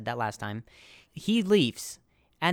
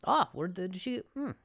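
imitating Kroom; 4 semitones higher, 4 dB quieter than usual. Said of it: "That's really good accent".

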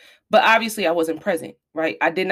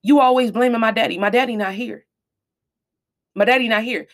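higher-pitched, heated protest; retold snapping back; 5 semitones higher, 3 dB louder than usual. Mother voices her teenage son snapping at her, heated protest. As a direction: imitate a teenager snapping at a parent. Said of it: "And he snapped and he was like".

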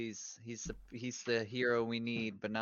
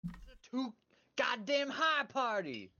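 mock candidate voice; 12 semitones higher than usual. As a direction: lift up and drag it out, mocking. Mimics the taxes guy.

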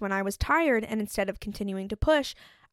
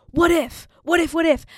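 sudden high loud alarmed "what if"; the body shouting its worries in a panic; anxious body voice; 6 semitones higher, 6 dB louder than usual. Retold or imitated. The body yanks her back down from excitement, alarmed shouting. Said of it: "your body brings you down a notch and is like".

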